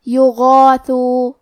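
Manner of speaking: یغاثو very slow, غین and alif thick and full; heavy recitation. Shown as a demonstration. Teacher demonstrating یغاثو, slow heavy recitation. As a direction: chant یغاثو with a heavy ghain. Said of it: "موٹی آواز میں پڑھیں گے".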